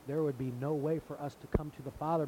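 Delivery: ordinary talk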